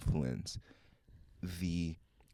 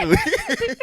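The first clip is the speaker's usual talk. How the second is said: laughing; 11 dB louder than usual. Real, not acted. Laughing at own joke.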